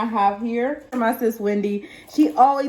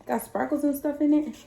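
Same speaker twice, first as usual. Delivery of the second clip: normal talking voice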